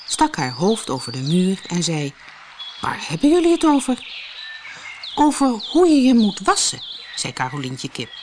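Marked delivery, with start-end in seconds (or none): 2.8-4.1 high, fast questioning voice; curious cow. A narrator's reading. Cow leaning over the wall, high and fast. 5.1-6.8 high hen voice, lilting. A narrator's reading. Hen answering the cow, high and lilting.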